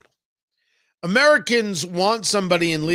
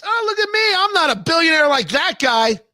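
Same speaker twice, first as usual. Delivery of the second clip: high-pitched mock self-promotion; mocking imitation; 9 semitones higher than usual. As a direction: parody a politician pleading in a raised voice. Mocking the candidate's regular-guy pitch.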